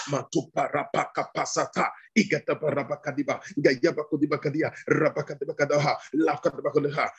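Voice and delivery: rhythmic, repetitive prayer chant; praying in tongues. Real, not acted. Preacher leading the congregation in prayer, praying in tongues. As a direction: fire off syllables in even, insistent bursts.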